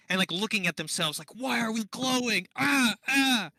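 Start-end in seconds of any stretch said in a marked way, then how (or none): 1.4-3.5 higher-pitched, lightly hammed-up alarm; mock panic. Game master voicing foes caught by a glowing spell, mock panic. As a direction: yelp as if startled, playfully panicked. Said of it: "kind of very panicked".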